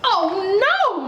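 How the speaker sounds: high squeak then alarmed cry; Mister Mouse squeaks in dismay at the broken tusk; squeaky mouse yell. Acted. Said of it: "Mister Mouse yelled".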